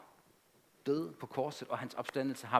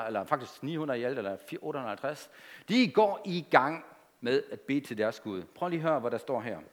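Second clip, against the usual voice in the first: normal talking voice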